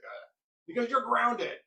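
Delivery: slow, high, loud stalling excuse; flustered excuse. Retold or imitated. Mother inventing a reason at the table, slow flustered excuse. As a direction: make it up on the spot.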